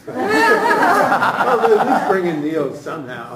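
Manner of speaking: amused, laughing insistence. Real, not acted. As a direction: laugh it off while pushing the point. Laughing off the "wouldn't add" remark.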